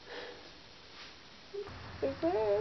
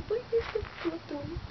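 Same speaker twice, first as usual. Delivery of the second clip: scared pleading; 9 semitones higher than usual. Real, not acted. Pleading with the wasp.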